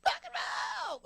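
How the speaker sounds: shrieking high rock-and-roll scream; over-the-top screamed cheer; rock shout. Acted. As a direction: belt it like a frontman. Hype shout before recording.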